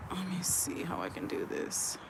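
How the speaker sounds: very soft, whispery thinking aloud; whispered self-talk. Real, not acted. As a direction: whisper it to yourself as you puzzle it out. Talking to herself while working something out.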